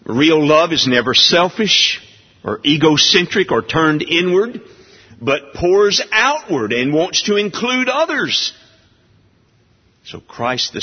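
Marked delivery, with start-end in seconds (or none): 0.0-8.5 raised, fervent pulpit voice; preaching. Acted. Preacher to his congregation, fervent preaching. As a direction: proclaim it to the whole church.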